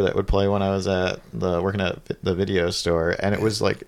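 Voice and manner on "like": normal talking voice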